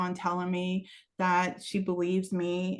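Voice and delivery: usual speaking voice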